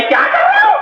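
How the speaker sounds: put-on startled girl's high cry. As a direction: squeak it out like the frightened girl. Girl voiced in retold story.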